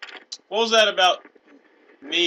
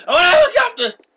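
high-pitched screamed demand, hammed up; hostage taker; 15 dB louder than usual. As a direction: scream the ransom demand in mock frenzy. Plays hostage taker making demands.

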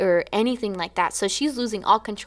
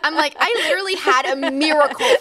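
laughing; 7 semitones higher than usual. Retold or imitated. Laughs while quoting herself.

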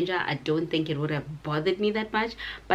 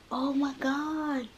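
much higher, slow and soft; awed gush; 9 semitones higher, 4 dB quieter, slower than usual. Real, not acted. Seeing the dress, high awed gasp. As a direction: sigh it out in awe.

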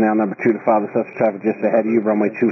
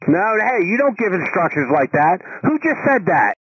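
sharp, raised scolding voice; angry scolding; 7 semitones higher than usual. Real, not acted. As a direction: snap at them, higher and annoyed. Controller scolds pilot on frequency.

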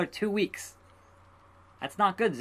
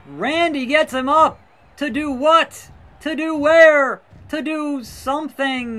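higher and louder play-by-play; rising hype, building to the peak; excited call; 8 semitones higher, 7 dB louder than usual. Real, not acted. Commentating a big moment.

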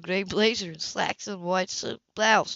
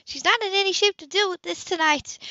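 Sybil defending; 12 semitones higher, 3 dB louder than usual. A narrator's reading.

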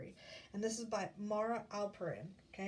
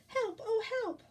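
very high, loud yelp; little chick calling for rescue; squeaked cry for help; 12 semitones higher, 6 dB louder than usual. Acted. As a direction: squeak out in panic.

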